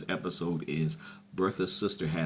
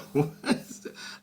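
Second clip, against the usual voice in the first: airy high laugh, no voice; real giggling delight; breathy laugh; 9 semitones higher than usual. Real, not acted. Cracking up at the scene.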